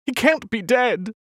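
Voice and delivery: high, disbelieving cry; desperate cry. A narrator's reading. Alice denying her baby is dead, high desperate cry. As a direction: refuse the news, voice cracking high. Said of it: "she cried".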